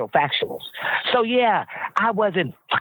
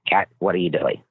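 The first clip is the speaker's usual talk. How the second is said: imitating [name]; 10 semitones lower than usual. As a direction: lower [name] impression. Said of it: "I'm doing this like him".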